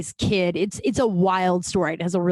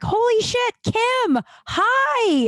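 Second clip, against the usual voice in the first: much higher, gushing mock celebrity voice; excited greeting; 3 dB louder, much slower than usual. Jason Segel greeting Kim outside, hammed-up high excitement. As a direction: squeal it out like a thrilled fan.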